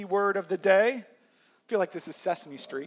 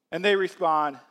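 usual speaking voice

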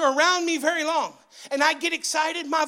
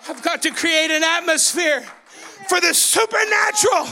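loud, driving revival preaching; full-force preaching; 4 semitones higher, 4 dB louder than usual. Real, not acted. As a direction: cry it out to the congregation, stretching key words.

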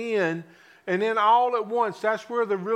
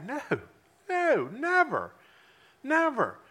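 Peter's denial; 5 semitones higher than usual. Retold or imitated.

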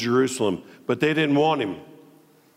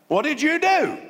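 demanding king; 11 semitones higher than usual. Acted. King demanding account from servants.

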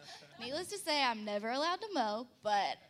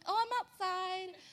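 innocent reply; 8 semitones higher than usual. Retold or imitated. Her innocent reply quoted.

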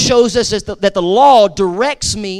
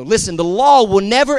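normal talking voice

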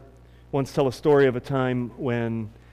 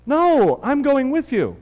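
high, loud reassuring dad; hammed-up fatherly "no, I'm with you"; 11 semitones higher, 6 dB louder than usual. Father reassures his son he won't travel alone, hammed-up warmth. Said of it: "And the father said".

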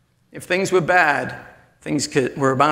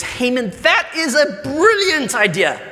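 higher, hearty royal approval; pleased king; 8 semitones higher than usual. Acted. King praising Haman's idea, higher and hearty. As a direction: praise him grandly. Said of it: "And the king says".

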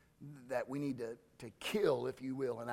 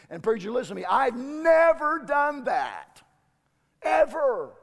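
indignant shout; 12 semitones higher, 14 dB louder than usual. Pretend. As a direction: shout back in offended protest. Imagined listener's indignant outburst.

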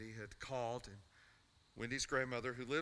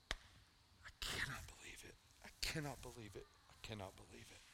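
dejected whisper; 7 dB quieter than usual. Retold or imitated. Son dropped his head, dejected.

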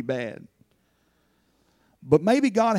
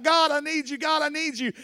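calling out to God; 10 semitones higher than usual. Acted. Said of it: "to call out".

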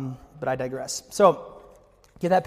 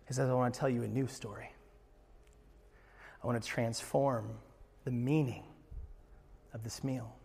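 hushed reverent preaching; 5 semitones lower, 11 dB quieter than usual. Acted. Hushed reverent close of sermon.

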